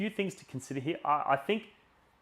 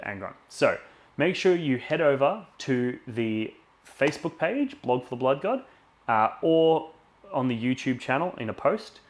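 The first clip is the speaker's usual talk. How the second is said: loud, pumped-up stream announcer; hyped giveaway announcement; 7 dB louder than usual. Acted. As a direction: sell the prize draw with energy. Louder run as he announces the patron draw.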